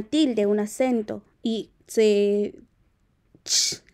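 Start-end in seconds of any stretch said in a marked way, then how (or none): 3.4-3.8 ch alone, with no vowel; short hushing ch, no letter name; accented ch sound. Shown as a demonstration. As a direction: demonstrate ch as a bare consonant burst. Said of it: "se pronuncia como ch".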